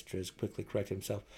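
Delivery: ordinary delivery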